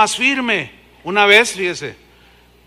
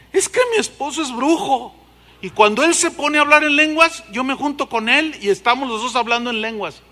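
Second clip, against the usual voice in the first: higher voice of the confessing woman; 5 semitones higher, 4 dB louder than usual. Retold or imitated. Woman admitting her husband is a sorcerer, higher voice. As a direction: confess it a little tearfully.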